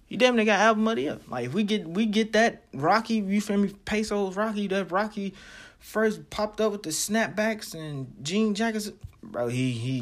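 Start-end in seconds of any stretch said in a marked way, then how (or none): 0.0-1.2 much higher and louder, fired up; hyped emphatic repeat. Real, not acted. Repeats his claim, hyped about Rocky. 1.5-8.9 raised pitch, excited insistence; hyped fan. Real, not acted. Hyped fan recalling early Rocky.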